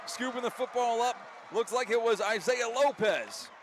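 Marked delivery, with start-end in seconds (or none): none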